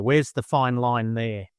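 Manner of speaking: normal talking voice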